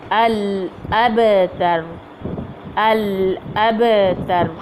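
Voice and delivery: अल अबतर drawn out slowly with a bounced ब; slow recitation. Shown as a demonstration. Teacher reciting the ending slowly for students. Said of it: "कलकलाए सुगरा".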